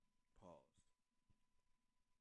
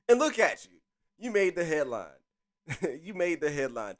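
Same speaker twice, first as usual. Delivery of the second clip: excited teasing; 5 semitones higher, 7 dB louder than usual. Real, not acted. Teasing the actor, laughing.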